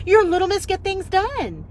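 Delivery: high, loud, delighted; the counter woman insisting, high and excited; excited insistence. Retold or imitated. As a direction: insist like a thrilled fan. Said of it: "She goes".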